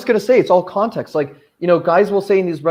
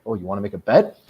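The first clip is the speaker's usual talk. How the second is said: lower, cocky dare; low cocky challenge; 8 semitones lower than usual. Retold or imitated. Boyfriend daring his girlfriend, low and cocky. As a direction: dare her, low and smug.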